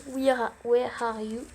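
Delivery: slow English with a French accent. Real, not acted. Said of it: "On parle de mon accent anglais". (anglais avec un accent français)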